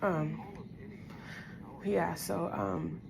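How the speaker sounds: quiet, holding back tears; emotional, subdued. Real, not acted. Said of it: "I'm getting emotional again".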